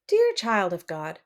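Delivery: ordinary delivery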